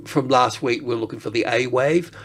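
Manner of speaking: usual speaking voice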